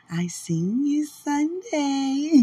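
high, slow and delighted; excited drawn out. Real, not acted. To Dwight, about seeing him Sunday, slow and delighted. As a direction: stretch it out with glee.

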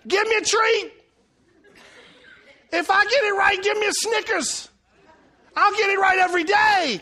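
high, loud, hammed-up pleading; eager begging. Husband asking wife for a treat when right, eager. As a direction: beg excitedly like a dog after a treat.

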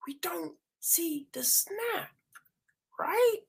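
soft, high airy whisper. Real, not acted. Hushed aside to viewers that the snap never comes, high whisper.

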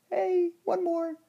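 high, slow put-on voice of a tipsy old lady; mock old-lady demand.

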